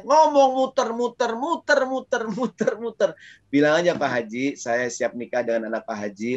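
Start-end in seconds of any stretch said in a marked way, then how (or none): 0.1-3.1 mock rambling suitor. Mocking roundabout suitor.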